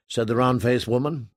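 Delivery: ordinary delivery, nothing marked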